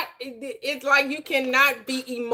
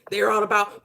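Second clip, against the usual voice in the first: ordinary talk, nothing marked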